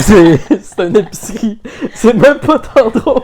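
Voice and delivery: talking through a fit of giggles; can't stop laughing while speaking. Real, not acted. Fit of giggles, fou rire.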